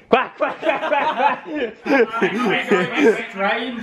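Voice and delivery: high-pitched, laughing duck quacks. Acted. Vlogger dubbing over his swearing, high duck quacking. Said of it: "Duck quacks".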